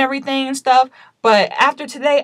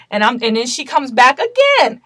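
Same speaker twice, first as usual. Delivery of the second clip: ordinary talk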